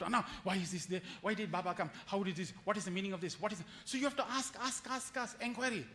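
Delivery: ordinary delivery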